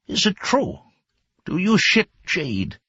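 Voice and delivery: high, sneering mock question; mocking cop. Squatting officer mocking Ma's face, high sneer. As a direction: taunt him cruelly.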